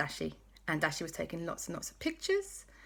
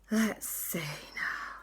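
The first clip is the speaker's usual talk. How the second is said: slow, hushed whisper; whispering while searching the bag; much slower than usual. Acted. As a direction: draw it out in a secret hush.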